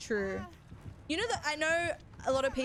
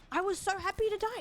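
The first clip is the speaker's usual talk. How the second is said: much higher and fast, eager; excited repeat; 9 semitones higher, faster than usual. Real, not acted. Streamer gushing to chat about dying, higher, fast. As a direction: gush it out like a relief.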